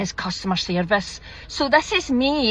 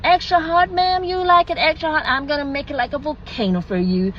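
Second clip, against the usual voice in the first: high-pitched, eager American server easing down; mock American drive-through enthusiasm; put-on American accent; 5 semitones higher than usual. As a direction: imitate an over-obliging American barista. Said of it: "forgive the accent".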